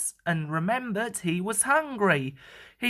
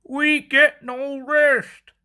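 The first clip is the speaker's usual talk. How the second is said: slow, grumbling engine voices; slow complaining chant; 4 semitones higher, 4 dB louder, slower than usual. A narrator's reading. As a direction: drag it out like tired grumbling engines. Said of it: "they complained as they clanked".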